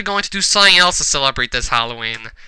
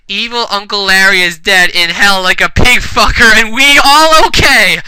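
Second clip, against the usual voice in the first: mock triumph; 5 semitones higher, 6 dB louder than usual. Character cheers the group up.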